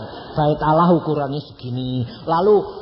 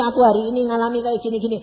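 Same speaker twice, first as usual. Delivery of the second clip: lover's letter voice; 6 semitones higher, faster than usual. Retold or imitated. Voicing a lover writing a letter.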